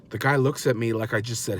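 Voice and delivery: usual speaking voice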